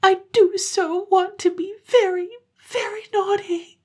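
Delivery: put-on high child voice, tearful longing; tearful little girl. Girl begging mother, high and tearful. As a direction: beg like a small child.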